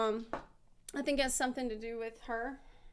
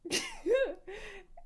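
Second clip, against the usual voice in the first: a high burst of laughter trailing off; real laugh; 8 semitones higher, 3 dB quieter than usual. Real, not acted. Streamer laughing at her own wrong Yelan lore, high falling laugh. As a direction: laugh at your own mix-up.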